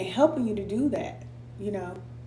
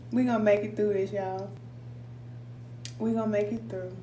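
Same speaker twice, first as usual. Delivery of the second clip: ordinary delivery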